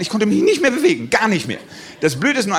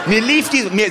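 normal talking voice

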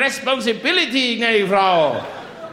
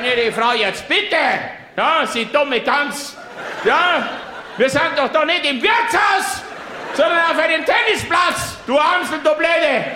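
furious rant; 5 semitones higher than usual. Acted. Tennisvater beschimpft Mutter lautstark.

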